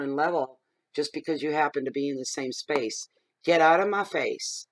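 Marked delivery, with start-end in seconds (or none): 3.4-4.7 loud and slow, each word hit; slow, loud, defiant order. Real, not acted. Defiant rant.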